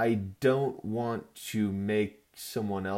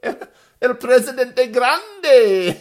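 mock grand title; 13 semitones higher, 14 dB louder, much slower than usual.

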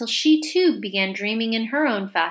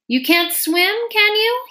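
king voice; 12 semitones higher, 5 dB louder than usual. Acted. King questions the knave.

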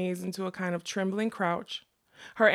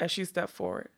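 usual speaking voice

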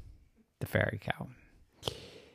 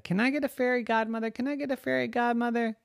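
put-on high, rapid pleading kid voice; little kid begging over and over; nagging child; 13 semitones higher, much faster than usual. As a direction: pester a parent in a quick, high whine. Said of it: "she nagged her mommy and daddy".

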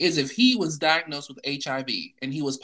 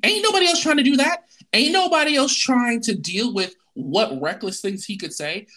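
much higher and louder, fast at first; heated outburst; 5 semitones higher, 6 dB louder than usual. Real, not acted. Venting to her co-host about festivals, loud and fired up. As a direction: burst out, fired up and indignant.

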